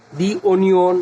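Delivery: দি onion, with দি said as "dee"; "the" as দি before vowel. Shown as a demonstration. Teacher giving a vowel example, দি onion with "dee". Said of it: "তখন আমরা দি বলবো".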